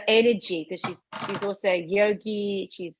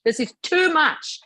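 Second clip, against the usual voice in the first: mock scream; 7 semitones higher, slower than usual. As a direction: imitate screaming by pitching it up. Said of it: "screaming now".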